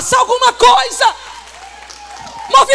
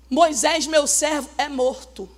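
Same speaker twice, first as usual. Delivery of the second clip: lower, slow and solemn divine voice; voice of God; 7 semitones lower, 7 dB quieter than usual. Acted. God telling Joshua that Moses is dead, low solemn voice. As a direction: speak as God, grave and final.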